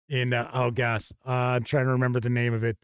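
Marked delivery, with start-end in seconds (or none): none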